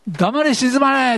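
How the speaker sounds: 「黙れ、静まれ」 slow, high and commanding, each word set apart; commanding rebuke. Shown as a demonstration. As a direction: order the storm to be still, slowly. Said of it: "あ、". (「黙れ、静まれ」をゆっくり高く威厳をもって)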